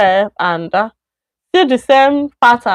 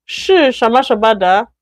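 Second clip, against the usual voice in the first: usual speaking voice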